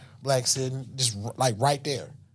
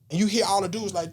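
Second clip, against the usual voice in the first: ordinary talk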